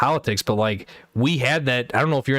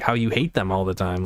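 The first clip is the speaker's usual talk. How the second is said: ordinary talk, nothing marked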